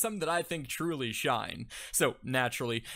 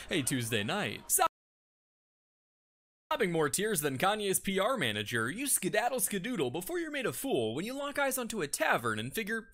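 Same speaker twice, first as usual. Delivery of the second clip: usual speaking voice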